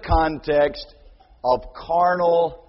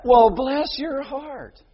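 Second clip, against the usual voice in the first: slow, higher mock sympathy; sarcastic; 8 semitones higher, 3 dB louder, slower than usual. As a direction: drip fake sympathy. Sarcastic reply.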